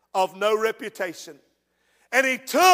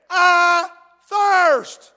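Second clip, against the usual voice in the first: proclaimed dying words; 7 semitones higher, 6 dB louder than usual. Acted. Jesus's dying words, preached.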